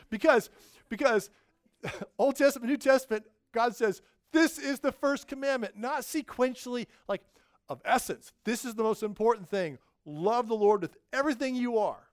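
loud, high, emphatic preaching; fervent preaching. Real, not acted. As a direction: preach it out with full fervor. Preaching at full force.

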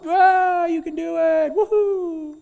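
very high, loud cartoon whoops; whooping crowd. Crowd whoops for the daredevil, high excited cheers. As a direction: squeal with delight.

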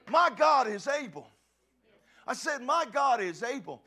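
loud, rising proclamation; full-force preaching. Real, not acted. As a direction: build the fire for the congregation.

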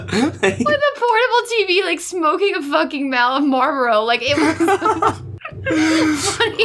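losing it mid-description; real helpless cracking up; laughing. Real, not acted. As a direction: push on through mounting laughter. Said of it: "making me laugh harder".

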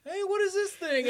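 high, light voice for Jonathan. Retold or imitated. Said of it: "being like".

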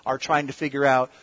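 ordinary talk, nothing marked

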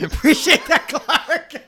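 high mock Eddie voice; Eddie impression. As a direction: echo Eddie, high and loud. Echoing Eddie quote.

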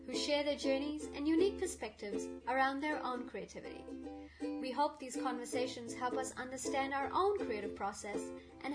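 bouncy scripted podcast intro; sing-song welcome over music; exaggerated cartoon intonation.

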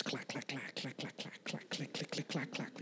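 quiet, toneless clacking noise; imitated clacking. Preacher imitates Wayne stumbling along the sidewalk, hushed clacking. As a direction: click it out softly like unsteady footsteps.

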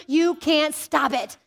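ordinary talk, nothing marked

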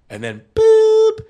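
high, drawn-out imitated beep. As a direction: squeak out the machine's beep. Imitating the answering machine beep.

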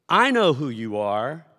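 loud, drawn-out outcry as the spirit; sudden shout. Acted. Demon names Jesus in the synagogue, shouting. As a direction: call it out loud and slow. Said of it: "I, I just shouted, sorry".

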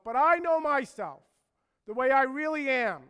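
high, very loud insistence; emphatic outburst, pitched well up; self-certain protest. Acted. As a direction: proclaim total confidence in yourself. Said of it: "We think just like Peter".

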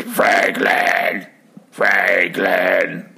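machine calling. Retold or imitated. Machine calling his name.

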